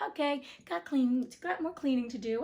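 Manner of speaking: ordinary delivery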